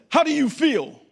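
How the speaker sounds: high, loud mocking demand; drill sergeant demand. Marine pastor taunting his late recruit, loud high sneer. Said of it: "And he said".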